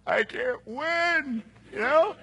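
high, slow exasperated whine. Retold or imitated. Young man to his mentor, exasperated whine. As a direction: throw up your hands, beaten. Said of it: "And I'm just like".

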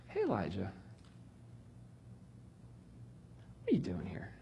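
hushed, breathy whisper to a runaway prophet; God's gentle whisper. Acted. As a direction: lean in and whisper the question. God gently questions Elijah.

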